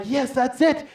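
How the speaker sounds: high, loud burst of excitement; thrilled, high-pitched yes; excited agreement. Retold or imitated. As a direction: shout it eagerly. Retells his eager reply.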